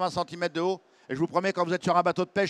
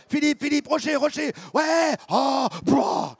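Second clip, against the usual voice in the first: alarmed shouting; 7 semitones higher, slower than usual. Retold or imitated. Reenacting crash on rocks.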